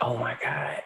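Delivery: soft, low and choked. Retold or imitated. Mother hearing her son's plea, choked up. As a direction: barely get it out, holding back tears.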